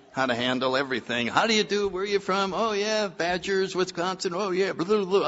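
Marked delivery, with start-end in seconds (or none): 1.3-5.3 chatty schmoozing. Retold or imitated. His chatty party self, voiced high.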